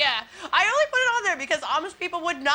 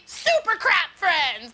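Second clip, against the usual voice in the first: raised, stretched mock cartoon announcer; mock superhero announcer; 3 semitones higher, much slower than usual. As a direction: announce a superhero team with hammed-up grandeur.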